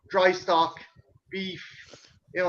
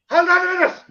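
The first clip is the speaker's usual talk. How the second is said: higher, louder mock call from upstairs; 10 semitones higher, 9 dB louder, faster than usual. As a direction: shout from the bathroom, laughing. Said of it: "all I heard was like".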